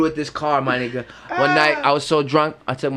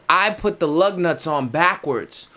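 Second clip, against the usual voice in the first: usual speaking voice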